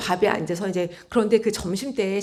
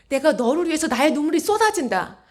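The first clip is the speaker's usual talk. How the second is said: high, fervent preaching voice; raised, pleading intensity; impassioned proclamation; 7 semitones higher, 3 dB louder than usual. Retold or imitated. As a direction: declare it with aching urgency, pitched up. Voices Jesus's impassioned tearful love.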